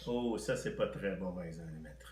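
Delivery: low, soft mock revulsion; mock disgust. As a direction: groan it like bad food. Disgusted at math "recipe.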